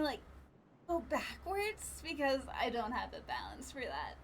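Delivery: ordinary delivery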